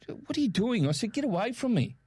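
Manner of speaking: high, exasperated mock protest; retold telling-off. Telling wife off at checkout.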